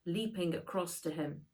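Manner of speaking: usual speaking voice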